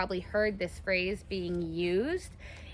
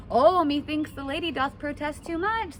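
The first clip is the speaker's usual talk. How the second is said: teasing someone whose denial gives them away; knowing tease; 7 semitones higher than usual. Pretend. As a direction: say it like you've caught them out. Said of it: "someone might say".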